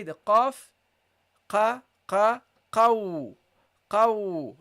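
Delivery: teacher drills qaf; Arabic qaf. Shown as a demonstration. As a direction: drill qa, qaw. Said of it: "This is how you pronounce it".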